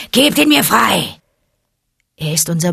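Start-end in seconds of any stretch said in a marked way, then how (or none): 0.1-1.2 louder, higher snarling demand; a predator howling for his prey; howling tiger. A narrator's reading. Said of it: "heulte Shere Khan".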